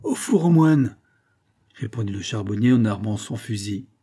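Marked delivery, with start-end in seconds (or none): 0.0-1.0 higher, louder furious answer; furious retort. A narrator's reading. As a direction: bark out the destination. Armed father answers in fury.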